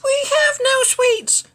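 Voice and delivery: very high squeaky ant voices; tiny ants. Acted. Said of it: "the ants replied".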